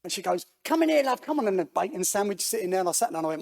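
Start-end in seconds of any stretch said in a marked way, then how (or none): 0.7-1.5 high-pitched motherly call; welcoming lady. Retold or imitated. Lady pulling a stranger into her kitchen, welcoming. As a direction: imitate a woman ushering a visitor in. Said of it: "this lady".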